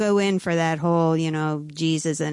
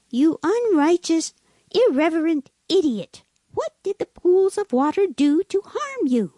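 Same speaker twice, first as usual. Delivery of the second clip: high-pitched indignant scolding; irritated outburst; 9 semitones higher, 3 dB louder than usual. A narrator's reading. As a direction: tell him off in theatrical indignation. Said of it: "Jesus was irritated".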